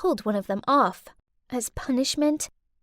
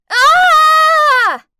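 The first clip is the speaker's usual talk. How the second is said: long, high, loud scream; 13 dB louder than usual. Acted. Captive girl heard during class, piercing scream. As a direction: scream at the top of your voice.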